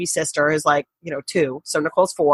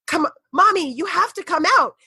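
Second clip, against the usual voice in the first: imitating young daughter; 9 semitones higher than usual. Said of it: "And Megan goes".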